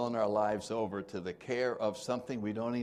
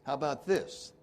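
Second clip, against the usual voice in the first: ordinary talk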